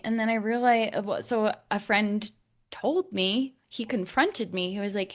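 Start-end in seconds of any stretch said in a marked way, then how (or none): none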